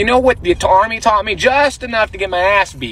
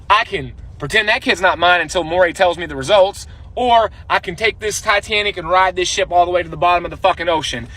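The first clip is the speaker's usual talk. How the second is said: normal talking voice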